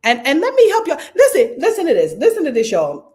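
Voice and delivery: high, loud and laughing through the words; laughing excitedly. Real, not acted. Host laughing while calling her viewers to listen, high and loud.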